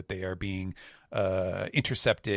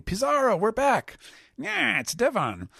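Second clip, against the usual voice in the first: much higher, louder woman's call; Devon voice; 14 semitones higher, 4 dB louder than usual. Acted. Devon hails Pizarro from the raft, high calling voice. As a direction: call out across the sea to the island. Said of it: "She's saying".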